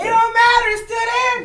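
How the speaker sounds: high put-on voice.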